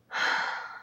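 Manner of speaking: long, voiceless exhale; heavy sigh. Real, not acted. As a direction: sigh after venting. Sighs after venting about dishes.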